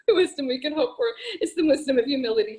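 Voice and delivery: amused disbelief breaking into the quote; laughing. Real, not acted. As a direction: laugh your way through the line. Laughing while quoting the poem.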